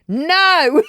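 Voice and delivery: high, loud defiant no; a mum imitating her daughter's defiant yell; mock shout. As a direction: yell it back like a teen. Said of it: "all I heard in the background was".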